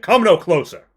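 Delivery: loud, high threat; warning shout. Acted. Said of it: "he raises his bow and he's like".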